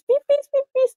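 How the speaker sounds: high, loud imitation of a small child; toddler calling out. Toddler needing to pee, high-pitched urgent imitation. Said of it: "jadi begitu dia mau pipis".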